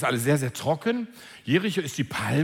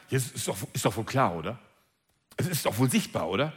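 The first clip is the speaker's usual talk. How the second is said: lower, indignant it's-obvious tone; exasperated; 8 semitones lower than usual. Pretend. Believer tells Jesus it's obvious, low and exasperated. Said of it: "könntest du ja sagen".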